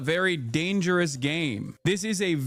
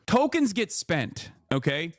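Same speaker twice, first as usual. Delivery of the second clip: ordinary delivery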